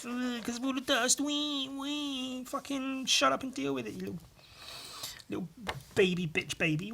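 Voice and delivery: high, soft mocking whine; mocking whiny voice. Taunting himself about his wheezing, high mocking whine. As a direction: sneer at a wheezing crybaby. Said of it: "Me making fun of myself".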